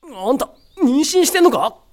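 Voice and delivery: high, loud startled question; stunned outburst; shocked. Acted. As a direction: blurt it out in disbelief. Shock at noticing the pregnancy.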